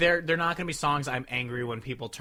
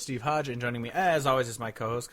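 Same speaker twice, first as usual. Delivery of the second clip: normal talking voice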